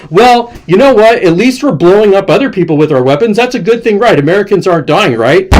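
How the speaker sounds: high-pitched, sneering imitation; mock liberal Democrats. Democrats justifying sending weapons abroad, mocked as smug. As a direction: parody a self-satisfied justification, pitched high. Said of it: "Democrats are like".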